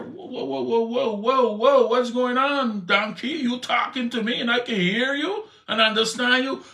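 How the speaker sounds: a man stunned his donkey talks back; startled disbelief. Pretend. Rider shocked to hear his donkey speak, startled.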